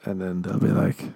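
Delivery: normal talking voice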